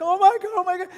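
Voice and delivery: high, loud mock panic; hammed-up shriek of a panicking bystander; imitated freaking out. Young mom seeing the injured rider, imitated freak-out. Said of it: "started freaking out".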